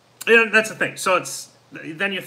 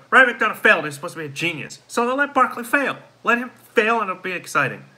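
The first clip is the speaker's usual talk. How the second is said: worked up; 7 semitones higher than usual. Real, not acted. Heated rant about the GM.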